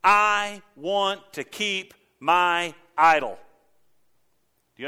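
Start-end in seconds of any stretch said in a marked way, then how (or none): 0.0-3.4 slow drawn-out stubborn no; stubborn refusal. Retold or imitated. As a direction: whine like a child keeping a toy. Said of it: "people are saying".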